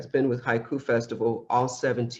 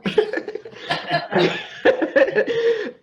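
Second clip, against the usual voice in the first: very high airy giggle; squealing laugh; 3 dB louder than usual. Real, not acted. Host laughs again.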